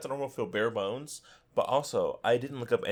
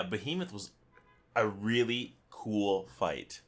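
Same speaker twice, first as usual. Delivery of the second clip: ordinary talk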